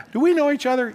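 lightly hammed-up surprise; mock surprise. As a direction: play the clueless greeter. Retold awkward church encounter.

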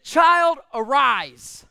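put-on CHILD called out loud and high, then arise; loud command. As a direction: raise the dead with a shout. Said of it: "I don't think he had to raise his voice".

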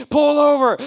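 loud high shout; Jesus shouting at the driver to stop; shouted command. Retold or imitated. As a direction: shout the order at him.

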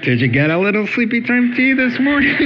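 high, fast playful jab at a sleepy listener; playful ribbing from the pulpit; teasing. Real, not acted.

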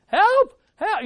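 high, sharp yell for help; sudden high-pitched mock shout; drowning cry. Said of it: "when you're drowning".

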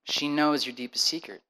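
low, soft, measured counsel; calm him with steady advice; calm counselor. Retold or imitated. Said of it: "And he goes".

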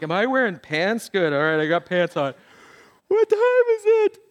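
half-awake servant checking himself; drowsy morning servant; groggy servant. Retold or imitated.

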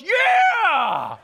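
high, loud joyful yell; thrilled kid shout. Retold or imitated. Little son cheering at dawn, loud high yell. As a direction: shout it like a delighted boy. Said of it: "he just goes".

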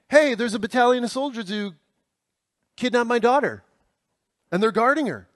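high-pitched mock urgency; hammed-up alarm with dramatic pauses; action-movie hero. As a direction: set up the peril like a cheesy action movie. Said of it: "it was the lone guy".

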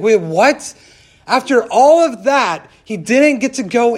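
loud, high, incredulous outburst; upset disbelief. Retold or imitated. Upset friend reacting to Moses' fate, loud disbelief. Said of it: "so upset".

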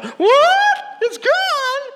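put-on very high, loud childish scream; hammed-up shriek of a shocked child; mock kid shriek. Owner's kid in the background hears the news, mock shrieking. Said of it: "you hear her kid in the background".

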